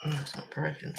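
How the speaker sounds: breathy whisper to herself; a hushed, unvoiced mutter under her breath; whispered aside. Real, not acted. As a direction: whisper as if checking the work. Muttered aside while painting.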